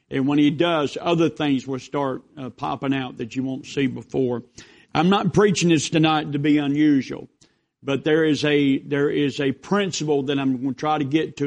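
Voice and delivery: loud, ringing pulpit voice, words driven out; preaching. Acted. Pastor opening the Christmas sermon to his congregation, preaching loudly. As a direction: preach it to the whole church.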